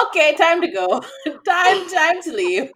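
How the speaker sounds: high, loud mock-alarmed call; urgent retold exit call. Friend hustling them away from a fight, loud urgent call. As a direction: imitate someone hurriedly breaking it up, laughing through it.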